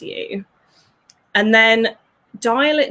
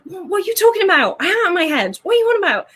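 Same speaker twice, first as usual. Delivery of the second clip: squeaky baffled outrage, much higher; high indignant protest; 9 semitones higher, much faster than usual. Retold or imitated. Younger self protesting to her friend, high and indignant. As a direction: play your younger self, bewildered and defensive. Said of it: "And I was like".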